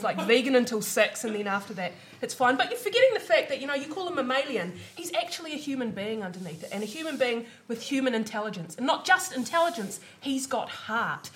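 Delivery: ordinary delivery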